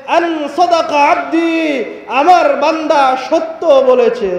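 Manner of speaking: very loud, high proclaiming sermon; preaching at full force. Acted. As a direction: proclaim it like a call from heaven. Heavenly caller proclaimed at top voice.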